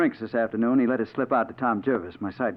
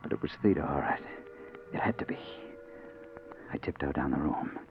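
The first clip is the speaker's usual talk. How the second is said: hushed narration; 4 semitones lower than usual. A narrator's reading. Hushed noir narration in dark ward.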